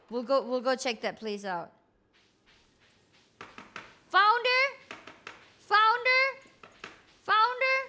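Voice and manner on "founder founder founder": calling out. Real, not acted. Calling for Founder in game.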